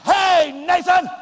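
sudden loud shout across the room; shouting a name. Shown as a demonstration. Preacher demonstrating yelling at a talking boy, loud shout. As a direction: bark the child's name to show the wrong way. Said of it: "I'm not gonna say".

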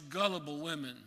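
usual speaking voice

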